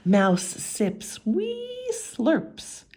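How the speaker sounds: slow, high dainty telling; slow storytelling. A narrator's reading. Narrator describing mouse sipping tea, slow and dainty. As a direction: savour each little word.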